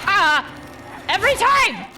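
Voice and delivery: real jump-scare cry, high and loud; startled, high-pitched exclamation; startled yell. Real, not acted. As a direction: yelp in fright, then loud exasperation. Startled by the game.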